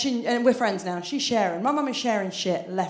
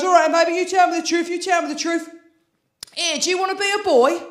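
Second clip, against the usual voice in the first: imitating dad; 6 semitones higher, 5 dB louder than usual. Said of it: "He goes".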